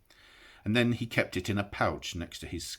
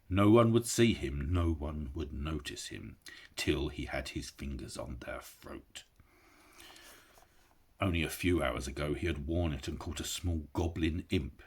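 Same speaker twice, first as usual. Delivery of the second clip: ordinary delivery, nothing marked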